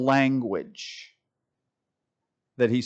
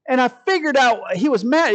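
imitating a comedian's high, loud delivery; comedian bit; 10 semitones higher, 5 dB louder than usual. Preacher quoting comedian on the mad pastor, exclaiming. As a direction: punch it out like a stand-up complaint. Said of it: "the comedian I was watching".

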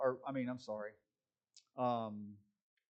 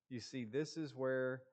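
normal talking voice

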